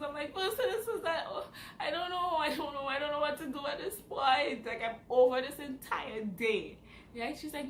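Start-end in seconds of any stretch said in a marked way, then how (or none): 0.3-6.8 high, loud words half laughed, half cried; laughing through tears. Retold or imitated. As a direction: blurt it out close to tears, laughing at the mess. Phone to mom, breaking down.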